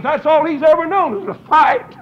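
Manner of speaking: ordinary talk, nothing marked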